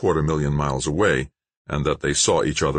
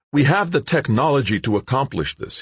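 high, firm, insistent claim; won't be doubted; insisting; 10 semitones higher than usual. A narrator's reading. Scientist insisting to the interviewer, firmly. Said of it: "he insisted".

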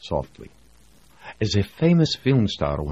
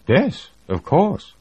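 slow, much higher smug reply; smirking; 13 semitones higher, 4 dB louder, slower than usual. Acted. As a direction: let the smirk drip through. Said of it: "He was smirking".

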